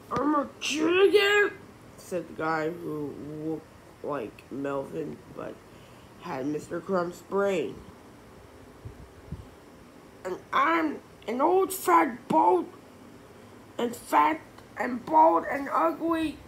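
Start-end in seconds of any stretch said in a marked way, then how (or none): 0.1-1.5 high, loud disbelieving question; surprised. A narrator's reading. Shocked at mirror. 10.2-16.4 put-on high, loud wailing kid; crying out. A narrator's reading. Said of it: "cried the guy".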